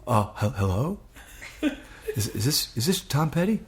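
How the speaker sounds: halting, rising question; acted starstruck hesitation; sheepish, timid. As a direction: stammer meekly into the phone. Sheepishly returns to the famous caller.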